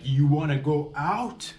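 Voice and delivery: slow, drawn-out retold dare; stretched-out challenge, rising at the end; mock fighting challenge. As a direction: imitate someone spoiling for a fight. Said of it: "Papa Bear's ready to go now".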